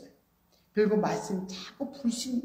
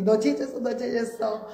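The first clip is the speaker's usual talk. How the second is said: taunting, accusing jeer; mock the believer like the devil; 4 semitones higher, 5 dB louder than usual. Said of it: "이러면서 우리를 조롱할 수 있어요".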